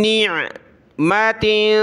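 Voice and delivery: निअमतिन with a nasal hum on the end. Shown as a demonstration. Teacher recites, nasal tanween. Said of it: "यहाँ भी नाक में आवाज़ पैदा होगी".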